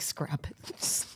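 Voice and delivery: a mock whisper, unvoiced; whispered quote. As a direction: breathe it as a hushed imitation of Mike. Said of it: "Mike's like".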